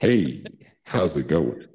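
low, drawling rock-and-roll crooner; deep, suave greeting in a star's voice. Pretend. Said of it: "you got some [name] vibes going".

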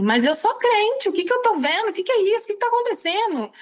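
reliving a panicked "what is happening?" moment; bewildered disbelief. Retold or imitated. Believer recalls questioning what she was seeing, bewildered.

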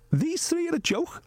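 high, amused mimic of wife scoffing; mock her scornful disbelief; imitating wife mocking. Husband imitating wife laughing at the pundits. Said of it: "she was like".

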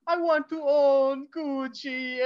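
mock Italian accent. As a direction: put on a camp Italian accent, drawn out. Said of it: "you just see, like, [name]".

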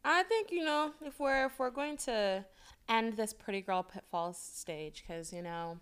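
put-on soft, hushed pretty girl voice. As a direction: put on a softly spoken pretty-girl persona. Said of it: "putting on your pretty girl voice".

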